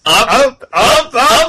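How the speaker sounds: high, loud chanted shout; excited shouting. Acted.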